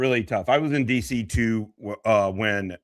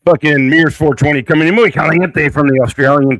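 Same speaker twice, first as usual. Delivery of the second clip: loud, higher hype-man call; hype shout; 6 semitones higher, 11 dB louder than usual. Real, not acted. Streamer loudly welcomes Mears from the Australian contingent. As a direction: announce him like a boxer walking out. Hyping the next streamer's arrival.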